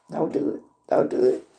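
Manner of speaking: hushed, breathy don't do it; under-the-breath warning to self; whispered self-warning. Retold or imitated. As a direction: whisper it to yourself to hold back. Telling herself to hold back.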